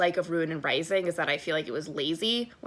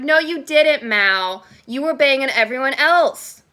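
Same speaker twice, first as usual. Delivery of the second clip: loud, heated comeback at Mal; angry retort; 5 semitones higher, 9 dB louder than usual. Retold or imitated. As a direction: snap back at him, loud and fed up. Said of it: "I'm like".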